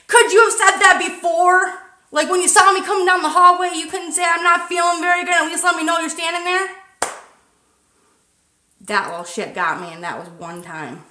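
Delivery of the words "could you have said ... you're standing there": high, loud exasperated rant; exasperated scolding. Retold or imitated. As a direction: vent at her, high and raised. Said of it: "And I'm like".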